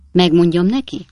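ordinary delivery, nothing marked

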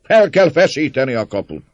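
high, loud rallying cry; voice from crowd. A narrator's reading. Man inciting the crowd against the gate, shouting. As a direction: urge the mob with a shout. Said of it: "hangzott egy szózat a tömeg között".

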